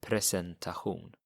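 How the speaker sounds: Presentation Swedish style, ending in a throaty hoon; Swedish pronunciation. Shown as a demonstration. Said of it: "what about presentation?".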